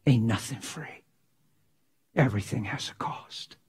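a hushed preacher's whisper. Acted.

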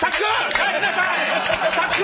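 frantic dad scolding; man scolding child. Retold or imitated. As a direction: yell at a kid in a store. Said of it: "スリラーみたいに".

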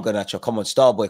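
ordinary talk

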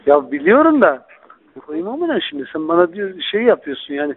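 ordinary talk, nothing marked

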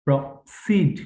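Proceed with a short first syllable, then a strong push on "seed"; push on second syllable. Shown as a demonstration. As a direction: break after "pro", punch the "seed". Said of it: "এখানে একটা ধাক্কা হবে".

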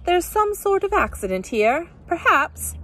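put-on high-pitched, affected English accent; fake English accent. A narrator's reading. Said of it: "she said in a fake English accent".

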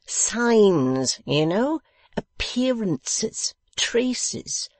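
pompous host explaining to a foreigner. A narrator's reading. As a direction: lay out each word grandly.

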